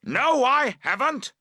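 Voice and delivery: high, loud defiant shout. Acted. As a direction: shout back in flat denial. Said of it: "he shouts".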